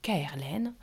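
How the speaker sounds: usual speaking voice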